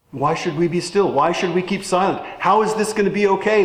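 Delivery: usual speaking voice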